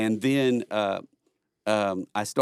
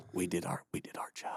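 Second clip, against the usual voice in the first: whispered, much softer deadpan; ironic whisper; 5 semitones lower, 13 dB quieter than usual. Acted. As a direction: hush it like a secret confession. Leaving country, ironic pride.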